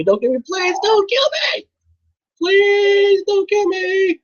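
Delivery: high, slow desperate shriek; terrified horror victim. Real, not acted. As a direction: scream for mercy in terror. Bear attacking, desperate pleading.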